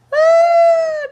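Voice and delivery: a high, loud laughing shriek. Real, not acted. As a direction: let out a sudden shrieking laugh. Bursts out over her mistake.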